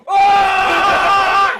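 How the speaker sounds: long, high, loud scream; sudden held yell of fake hype; mock hype scream. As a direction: imitate friends screaming down the phone. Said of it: "all fake hyping in the phone".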